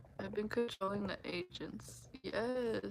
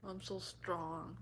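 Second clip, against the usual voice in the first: slow, softer, lower smug voice; mock boastful; 5 semitones lower, 9 dB quieter, slower than usual. Mimicking shoppers who act tough, slow and smug. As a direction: brag lazily under your breath. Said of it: "they're like".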